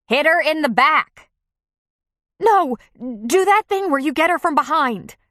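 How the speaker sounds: high, loud urgent calls; frantic shouting. Acted. Diners yelling to save choking friend, frantic. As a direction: shout advice in a panic. Women shouting advice in panic.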